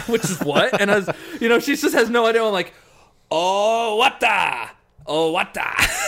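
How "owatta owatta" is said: put-on slow, stretched drunken Japanese; japanese learner accent. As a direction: insist in broken Japanese. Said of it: "drunk trying to speak Japanese".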